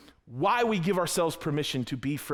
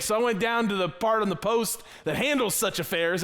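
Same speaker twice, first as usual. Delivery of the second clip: ordinary talk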